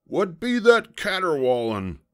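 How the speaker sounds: hollering pirate captain, high and loud; Braidbeard. A narrator's reading. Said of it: "hollered Braidbeard".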